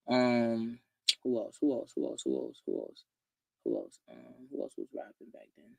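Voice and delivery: very soft, low thinking aloud; muttering to self. Real, not acted. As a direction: repeat it under your breath while searching memory. Thinking aloud, searching memory for names.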